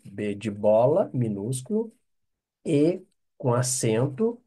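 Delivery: usual speaking voice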